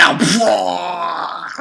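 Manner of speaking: loud burst of a fiery roar; hammed-up fire breath shout; mock dragon flame sound; mock blast cry. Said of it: "And he's like".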